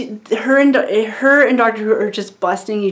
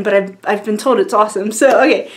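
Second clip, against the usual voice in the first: normal talking voice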